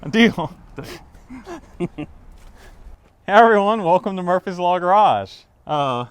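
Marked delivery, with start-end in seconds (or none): 3.3-5.3 loud, high upbeat channel welcome; vlog intro. Acted. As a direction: greet the viewers with energy. Show intro greeting.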